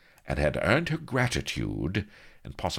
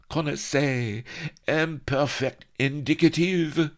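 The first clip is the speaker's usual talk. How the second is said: chortling; 12 semitones higher, 4 dB louder than usual. A narrator's reading. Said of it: "Happy Dan was chortling".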